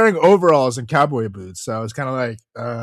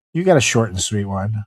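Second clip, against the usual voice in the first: normal talking voice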